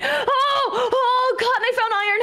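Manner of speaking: high squeaky mock of his excitement; mocking impression. Girlfriend mimicking boyfriend's iron squeal, mocking. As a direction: parrot his squeal to tease him.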